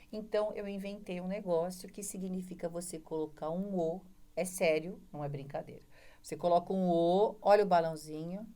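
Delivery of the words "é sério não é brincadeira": normal talking voice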